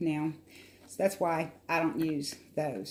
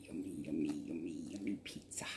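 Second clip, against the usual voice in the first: whispering; 14 semitones lower, 15 dB quieter, faster than usual. Real, not acted. Savoring pizza while eating.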